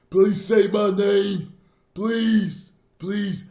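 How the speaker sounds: loud, high, drawn-out mock plea; over-the-top pleading, cried out high; mock begging. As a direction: beg in a hammed-up wail.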